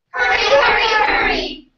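high shouting repeat; shouted echo. Acted. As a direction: yell it back. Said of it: "class shouts echo".

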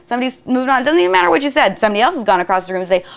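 ordinary talk, nothing marked

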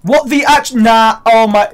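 loud, high, broken-off disbelief; exasperated shouting. Real, not acted. Gamer fed up with a stupid game, exasperated shouting. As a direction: shout it in real disbelief, breaking off.

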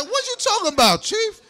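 high, disbelieving "Chief?"; incredulous. Retold or imitated. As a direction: pitch it up in disbelief. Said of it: "I said".

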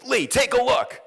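high, loud call from the doctor; insisting doctor. Retold or imitated. Doctor voiced in the story.

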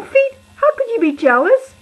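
high, loud, disbelieving; cat loudly scolding its jealous feet. Retold or imitated. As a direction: scold them, amazed they could be jealous. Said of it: "I go".

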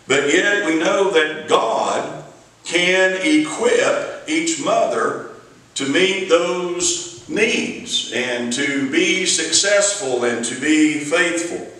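ordinary talk

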